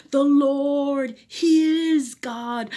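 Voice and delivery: loud, high, slow proclamation; crying out. Acted. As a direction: shout it together in awe. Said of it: "They cried out".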